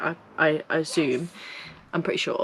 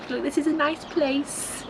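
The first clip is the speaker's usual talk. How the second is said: coaxing the dog; 8 semitones higher than usual. Pretend. Owner coaxing the scared rescue dog, high sweet voice. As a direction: sweet-talk a frightened puppy. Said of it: "Be like".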